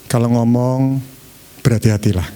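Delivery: low, measured, gentle counsel; calm, low-pitched caring caution; gentle advice. Shown as a demonstration. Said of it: "Beda dengan ini".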